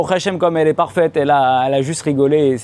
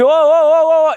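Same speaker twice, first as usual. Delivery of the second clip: loud, high calls to stop a bus; frantic hailing; 12 semitones higher, 5 dB louder, faster than usual. Acted. Passenger waving down a bus in Israel, frantic shouting. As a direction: shout after a bus pulling away.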